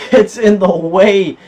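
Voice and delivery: Monreal stretched slow and high, mon-re-AL; drawn-out Monreal. Real, not acted. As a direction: sing out Monreal slowly and loudly. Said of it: "You can't say his name normal".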